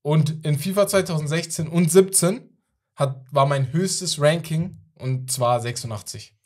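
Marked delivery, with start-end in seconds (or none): none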